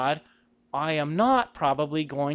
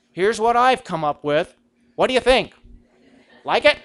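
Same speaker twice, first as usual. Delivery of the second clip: salesman pitching his plan, eager and louder; pitching God a plan; 5 semitones higher, 6 dB louder than usual. Pretend. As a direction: sell the idea to God like a pitch. Mock prayer presenting business plan.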